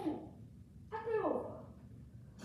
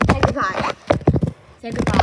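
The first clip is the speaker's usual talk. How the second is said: loud command; 4 semitones lower, 17 dB louder than usual. Real, not acted. Commanding the dog.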